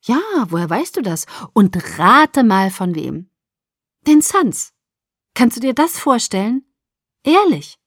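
delighted, high-pitched bursts; excited mother. Acted. Mother revealing who gave the contract, excited. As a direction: tease out the big news with glee.

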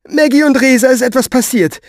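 high, loud, agitated; alarmed outburst. Acted. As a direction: jump to the worst conclusion. Woman fears the worst, agitated.

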